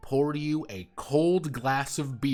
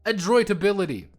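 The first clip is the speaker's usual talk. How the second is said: mock stern callout; 8 semitones higher, 8 dB louder, faster than usual. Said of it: "addressing bot by name".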